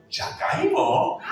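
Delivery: put-on high, slow, laughing child question; imitating kids. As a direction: mishear it like a giggling little kid. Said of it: "They say".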